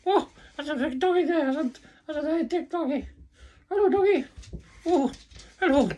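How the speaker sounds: put-on very high, cooing baby-talk voice; high-pitched sing-song hello to a toy; squeaky puppet-greeting voice. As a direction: coo over the new puppet like a delighted child.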